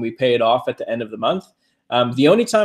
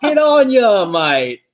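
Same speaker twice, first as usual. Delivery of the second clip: high, loud mock-Aussie with a laugh; terrible Australian accent; 8 semitones higher, 4 dB louder, much slower than usual. Host joking to an Australian viewer, laughing mock-Australian accent. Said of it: "terrible trying to do the accent".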